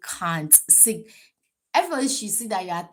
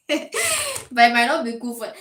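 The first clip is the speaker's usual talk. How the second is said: loud, laughing through the words; 4 semitones higher, 9 dB louder than usual. Real, not acted. Joking about her height, she laughs through the line. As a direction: giggle it out.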